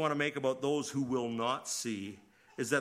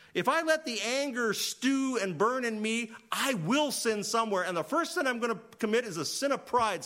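preaching; 9 semitones higher, 5 dB louder than usual. Real, not acted. Preacher heated about anger.

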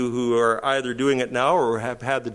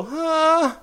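high, put-upon groan of a tired leader; weary exasperation; 7 semitones higher, slower than usual. Retold or imitated. Preacher voicing Timothy fed up with correcting people, exasperated whine. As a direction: whine it like you can't believe it's happening again. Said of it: "you're gonna go".

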